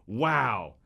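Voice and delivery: usual speaking voice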